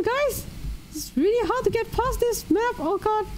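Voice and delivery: high-pitched mouse voice, fretting over a race; cartoon mouse commentator. Holds cartoon voice calling race.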